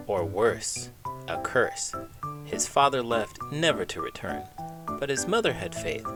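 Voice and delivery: ordinary delivery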